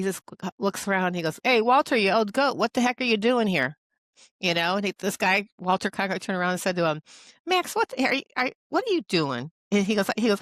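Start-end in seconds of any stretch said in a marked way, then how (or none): 1.4-3.8 louder and higher, a hearty joking call across the room; playful raised-voice greeting; friendly mock shout. Friend Max calling out to Cronkite. 7.5-9.5 sudden high-pitched startled exclamation; surprised, higher-voiced blurt; imitated surprise. Cronkite surprised to see Max.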